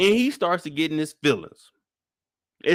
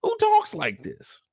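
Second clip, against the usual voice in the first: outraged at the text messages; incredulous; 13 semitones higher than usual. Retold or imitated. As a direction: stretch out who talks like this in disbelief. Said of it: "'cause I'm like".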